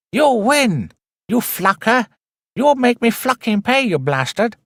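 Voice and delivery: hammed-up Chinese accent cursing the boss; mock Chinese accent. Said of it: "the Chinese guy's like".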